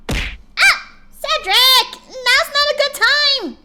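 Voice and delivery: high, loud yelp of alarm, then a slow shouted scold; yelp, then shout him off, slowly; startled shout. Real, not acted. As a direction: caught off guard mid-stream, shouting at Cedric to stop. Startled by Cedric interrupting stream.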